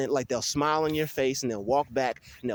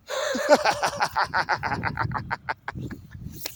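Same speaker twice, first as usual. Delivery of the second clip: drawn-out higher-pitched laughing fit; long laugh; 4 semitones higher than usual. Real, not acted. Vlogger laughing off the stranger, long laugh. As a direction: laugh it off at length.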